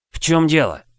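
sharp worried question. Acted. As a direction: ask in alarm. Same question, now pursuing.

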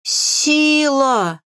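long, high theatrical howl; tragic wail. A narrator's reading. Grimoire lamenting from chest, tragic wail. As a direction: wail it out, holding the vowel. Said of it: "трагическим голосом взвыла".

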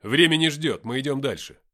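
loud order, easing toward the end; commanding. A narrator's reading. Narrator ordering Nikita to move on, commanding. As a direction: order the party onward. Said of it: "приказал я".

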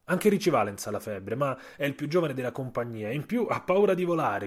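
ordinary delivery, nothing marked

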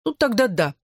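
thoughtful. A narrator's reading. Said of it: "задумчиво произнёс он". (задумчиво)

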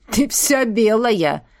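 slow, smug elf voice; gloating. Acted. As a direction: drip with malicious glee. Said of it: "Голос эльфа сочился злорадством".

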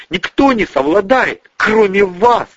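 high-pitched, urgent woman's voice; earnest explaining. Acted. Tamara explains herself to the men, high and urgent. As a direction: gush it out in self-justification. Tamara flatters, hand thrown up.

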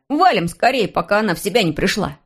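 plain reading voice

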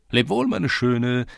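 slow, high singsong goodbye; lilting call to a sweetheart; called farewell. A narrator's reading. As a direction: draw it out high and loud. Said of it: "ließ sich ein anderer hören".

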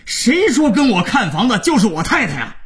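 high-pitched, exasperated phone rant; outraged caller losing it; frantic rage. A narrator's reading. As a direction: rant as if driven mad. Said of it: "刘总在电话那头已经抓狂了".

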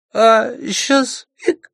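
put-on high drunken hiccup. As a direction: slur it with a hiccup. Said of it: "снова икнул юноша".

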